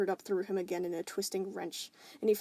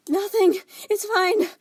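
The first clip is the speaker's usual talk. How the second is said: wheezing; 12 semitones higher, 13 dB louder, slower than usual. Acted. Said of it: "Stephen wheezed".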